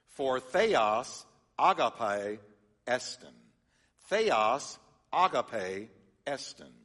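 "theos agape estin" drawn out slowly, each Greek syllable sounded; Greek reading. Shown as a demonstration. Pastor teaches the congregation "theos agape estin", slow and clear. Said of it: "reads like this".

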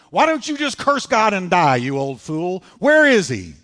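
loud, high-pitched taunting outburst; scornful wife. Retold or imitated. Job's wife berating her suffering husband, scornful. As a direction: throw it at him as a loud challenge.

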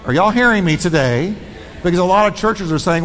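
ordinary talk, nothing marked

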